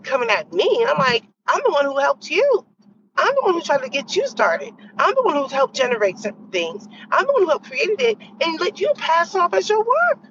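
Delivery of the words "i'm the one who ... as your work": rising, higher-pitched indignant insistence, building across each repeat; indignant retort. Retold or imitated. Retelling how she told off the jealous coworker, indignantly. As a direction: hammer each "I'm the one" harder as it climbs.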